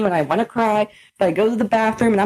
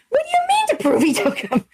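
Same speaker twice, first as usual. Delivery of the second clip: incredulous. Retold or imitated. Retold disbelieving reply to news.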